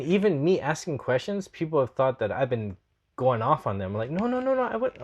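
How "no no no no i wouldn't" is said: high-pitched hasty backpedalling; retold protest. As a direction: pitch the denials up, acting out being misunderstood. Retells protesting when misunderstood.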